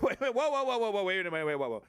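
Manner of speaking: startled backpedal; mock alarm. Caricature conservative catching his own slip, mock alarm. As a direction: catch yourself mid-sentence, panicking.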